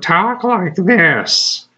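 high, drawn-out squeaky imitation. Imitating an odd coworker when she gets angry, high squeaky voice. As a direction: mimic her with a slow, pinched squeak. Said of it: "high squeak her voice".

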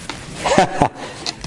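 short unvoiced chuckle; a quick airy laugh; breathy laugh. Real, not acted. Laughs at the pointing.

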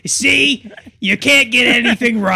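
very high, loud mocking scold; scolding parent. Scolding Henry.

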